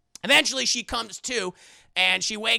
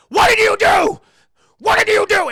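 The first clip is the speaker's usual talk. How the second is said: much higher, much louder yelling; furious shout; 14 semitones higher, 14 dB louder than usual. Retold or imitated. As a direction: scream the accusation at them. Howard furious after crash.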